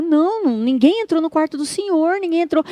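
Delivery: high-pitched imitation of nurses reassuring firmly; insistent denial. Nurses to a shouting patient, insistent denial. Nurses deny anyone entered the room.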